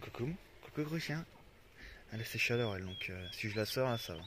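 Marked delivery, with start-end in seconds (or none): 0.0-1.2 cooing at dog. Real, not acted.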